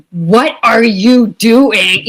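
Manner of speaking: loud incredulous question, slow; slow, loud what-are-you-doing; mock incredulous. Acted quote from past self.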